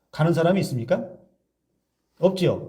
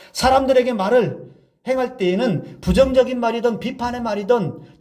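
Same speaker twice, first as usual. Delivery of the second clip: higher-pitched fervent preaching; 8 semitones higher than usual. Acted. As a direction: press the congregation, pitched up with conviction. Preaching at rising force. (높은 음으로 열정적인 설교)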